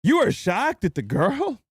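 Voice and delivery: high-pitched, drawn-out indignant rant; exasperated scolding. Real, not acted. Streamer berating a caller over a girl, exasperated. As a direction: scold with stretched, emphatic words in a high voice.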